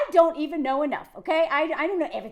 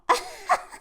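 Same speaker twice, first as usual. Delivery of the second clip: brief high-pitched laugh; a quick high burst of laughter; short laugh; 13 semitones higher than usual. Real, not acted. Laughs while introducing the post.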